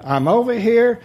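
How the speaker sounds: high, loud call from hiding; calling back. Acted. Adam calling back to God from the bush, loud and high. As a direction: shout it out innocently.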